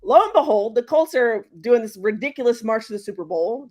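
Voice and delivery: normal talking voice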